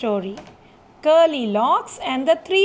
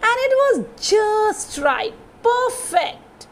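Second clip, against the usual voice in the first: delighted; 7 semitones higher than usual. A narrator's reading. Finding the right porridge.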